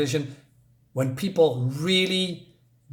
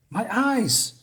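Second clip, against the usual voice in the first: scared cry; 8 semitones higher than usual. Acted. Reading a horror comic aloud.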